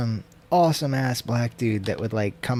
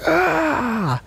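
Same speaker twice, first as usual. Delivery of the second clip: imitated wordless scream; 3 dB louder than usual.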